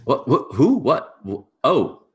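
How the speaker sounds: high, loud startled stammer; flustered servant caught. Acted. Servant caught picking by the farmer, flustered. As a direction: stumble over denials, pitched well up. Caught on neighbor's land, in character.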